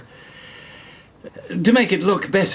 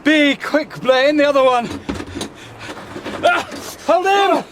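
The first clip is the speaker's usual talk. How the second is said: urgent shouts; 12 semitones higher than usual. Acted. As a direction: call out for help holding him.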